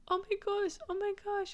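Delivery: mock high-pitched excited man; gushing pet store guy. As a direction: gush it like a guy overwhelmed by a cute lizard. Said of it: "And he's like".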